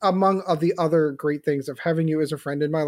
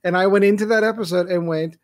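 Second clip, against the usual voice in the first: normal talking voice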